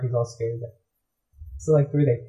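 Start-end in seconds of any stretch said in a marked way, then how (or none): none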